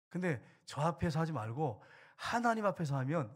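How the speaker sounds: ordinary talk, nothing marked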